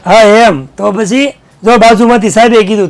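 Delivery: ordinary talk, nothing marked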